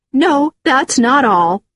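indignant rebuttal; angry retort. Acted. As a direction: stop them with a heated, drawn-out retort. Woman snaps back in quarrel.

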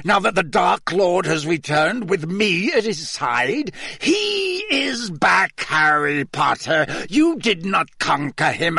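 high, crazed villain tirade with drawn-out words; voice rising uncontrollably. A narrator's reading. Moody threatens Harry for the Dark Lord, raving. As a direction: rave triumphantly, stretching the key words. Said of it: "his voice rising uncontrollably".

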